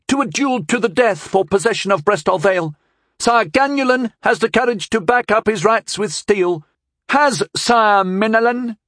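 put-on high, boastful boyish challenge; high-pitched, cocky young herald; overbold boy herald. A narrator's reading. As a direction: proclaim it too boldly, like a sandlot brag. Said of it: "a little too boldly for the occasion".

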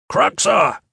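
high, loud trainer's bark; barked command. A narrator's reading. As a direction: bark the name out like a drill trainer. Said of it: "Trainer Fisk barked".

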